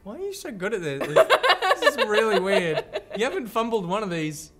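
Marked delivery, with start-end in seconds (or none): 1.1-2.8 high, loud, slow laughing. Real, not acted. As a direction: laugh through the words.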